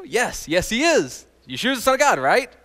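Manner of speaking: usual speaking voice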